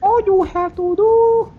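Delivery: high, loud, drawn-out mock Chinese accent; slow, exaggerated Chinese-accent parody. Shown as a demonstration. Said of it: "How do you speak like Chinese?".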